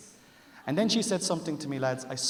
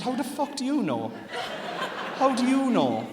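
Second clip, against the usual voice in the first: much higher, louder disbelief; husband stunned she already knows; shocked; 11 semitones higher, 5 dB louder than usual. Retold or imitated. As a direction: yelp it in total shock.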